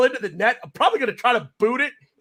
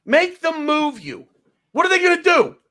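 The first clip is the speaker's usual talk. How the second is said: heated rant; 7 semitones higher than usual. Real, not acted. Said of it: "I get a little angry".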